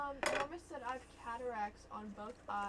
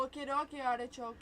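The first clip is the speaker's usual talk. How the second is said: louder, lightly put-on Irish lilt; Irish-style accent; 3 semitones higher, 6 dB louder than usual. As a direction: mimic an Irish accent loudly. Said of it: "That's how they say it".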